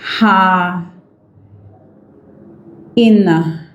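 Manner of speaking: "Ha, ina" broken apart with long pauses; syllable-by-syllable pronunciation. Shown as a demonstration. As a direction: sound out "Ha, ina" slowly in separate pieces. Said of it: "this is its phonetic transcription".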